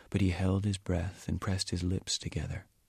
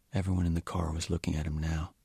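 ordinary delivery

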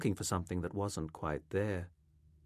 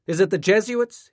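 loud, high-pitched questioning call; 11 semitones higher, 11 dB louder than usual. A narrator's reading. Mother asking her son who is at the door, calling out. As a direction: shout a question from another room. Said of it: "his mother called out".

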